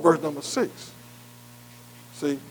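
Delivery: usual speaking voice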